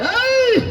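high, loud "hey" cry; sharp rise in pitch on one cry; urging yell. Real, not acted. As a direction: yell high to drive the team on. Urging on the racing team.

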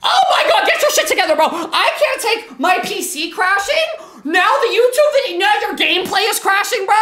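high-pitched frustrated outburst; exasperated rant. Real, not acted. Ranting at gameplay crashing on stream.

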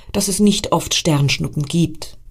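ordinary delivery, nothing marked

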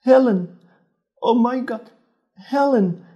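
high, loud anguished calling; terrible cry. A narrator's reading. Julia calling Helen in a terrible dying voice. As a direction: cry her sister's name in horror. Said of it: "she cried out in a terrible voice".

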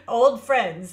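a laughing recovery after the joke about age. Real, not acted. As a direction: get the words out through a real laugh.

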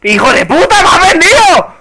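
loud furious shouting, voice jumping high; betrayed angry yelling. Real, not acted. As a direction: rage at the traitor. Teammate betrayed him in game.

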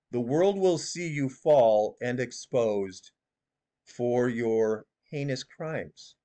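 slowly spelled-out doom, lightly voiced; slow prophecy imitation. Shown as a demonstration. Showing viewers how she says it, slow and solemn. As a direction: read it out like a solemn oracle, only a hint. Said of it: "She says it like this".

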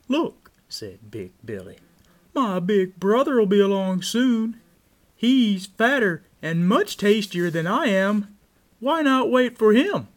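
high, loud billy goat voice; pleading goat. A narrator's reading. Big Billy talks the troll into waiting, high goat voice. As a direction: pitch well up and persuade the troll.

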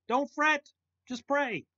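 urgent exhortation. Real, not acted. Preacher urging his listeners to stop worrying, loud and high. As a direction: press it on them like a rallying cry.

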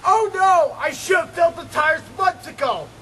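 normal talking voice

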